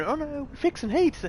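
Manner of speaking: high, sneering parody of a developer; mocking developer voice. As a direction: mimic their lame excuse high and whiny.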